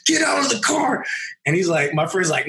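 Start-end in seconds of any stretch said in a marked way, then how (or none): 0.1-1.0 much higher, imitating a cop going ballistic; retold police shout, pitched way up; mock cop yell. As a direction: bark it like the furious officer. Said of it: "going ballistic outside the window".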